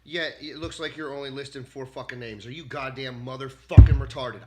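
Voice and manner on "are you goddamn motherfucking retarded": loud furious shout; sudden loud outburst at the AI; angry shout. Real, not acted. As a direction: blast the question at it. Insulting the AI.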